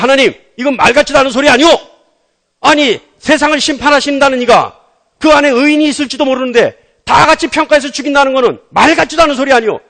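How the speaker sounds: loud, high-pitched mock remonstrance; browbeating protest. Preacher acting Abraham arguing with God, browbeating protest. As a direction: argue back hard, as if pressuring a superior. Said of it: "하나님을 윽박질러요".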